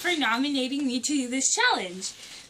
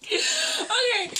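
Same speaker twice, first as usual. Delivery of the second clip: laughing; 10 semitones higher, 4 dB louder than usual. Real, not acted. Laughs while failing the challenge.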